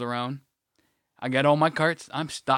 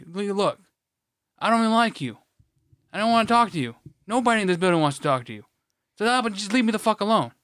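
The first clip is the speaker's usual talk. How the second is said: raised pitch, exasperated rebuff; fed-up telling-off; 7 semitones higher, 3 dB louder, faster than usual. Retold or imitated. Retold worker telling coworker to leave him alone, fed up. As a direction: shut them down, thoroughly annoyed.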